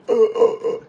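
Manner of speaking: acted winded gasps, high and strained; gasping for breath. Winded sailor tries to answer his chief, gasping. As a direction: imitate short, voiceless gulps for air. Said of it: "he can't get his breath".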